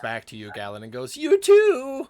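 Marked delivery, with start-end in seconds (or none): 1.2-2.1 very high, loud cracking shout. Acted. Young guard answering Galen's farewell, voice cracking. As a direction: call back with a pubescent squeak breaking through. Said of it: "Voice cracking".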